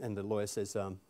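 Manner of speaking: ordinary delivery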